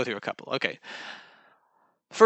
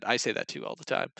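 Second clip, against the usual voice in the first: ordinary talk, nothing marked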